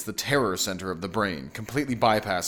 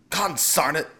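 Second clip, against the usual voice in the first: higher-pitched mild groan; exasperated husband's muttered oath; groaned curse; 6 semitones higher than usual. A narrator's reading. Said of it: "the husband groaned".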